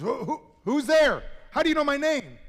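high, loud, startled stammering; frightened stammer. Acted. Man hanging from a branch answers a voice, frightened and high. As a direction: panic at an unseen voice.

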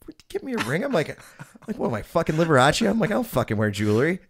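high-pitched mock disbelief; comically indignant protest, easing down in pitch; retold incredulity. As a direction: scoff at the idea, starting high. Retold disbelief at ring-size question.

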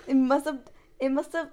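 normal talking voice

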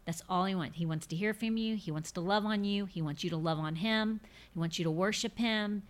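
ordinary talk, nothing marked

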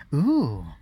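loud, rising-falling ooh; admiring ooh. Acted. Queen seeing a beautiful crown, admiring ooh. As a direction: coo with wonder at a prize.

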